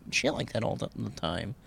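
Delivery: ordinary talk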